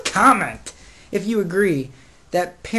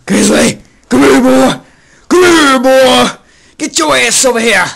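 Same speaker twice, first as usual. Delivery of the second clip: loud, high holler; shouting for dog; 8 semitones higher, 14 dB louder than usual. Real, not acted. Owner calls puppy over, loud high shout. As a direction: yell it across to a dog that won't come.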